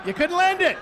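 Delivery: high, loud exclamation of disappointment; loud teasing lament. Acted. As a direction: cry out at the near miss. Same tease repeated, escalating.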